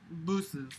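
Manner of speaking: Booses said low and soft as "boo-ses"; mock misspelling. Shown as a demonstration. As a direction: read Booses with a long oo like boo. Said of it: "he spells buses B U S S E S".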